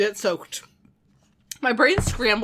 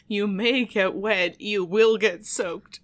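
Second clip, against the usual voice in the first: slow quoting; much slower than usual. Shown as a demonstration. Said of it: "My brain has scrambled that phrase".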